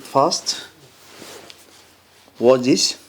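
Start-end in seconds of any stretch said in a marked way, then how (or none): none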